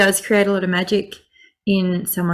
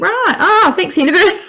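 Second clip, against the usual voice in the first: high-pitched exclaimed realisation, playfully sarcastic; mock exasperated thanks; 12 semitones higher, 4 dB louder than usual. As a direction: blurt a jokey thank-you upward to the heavens. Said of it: "and I think".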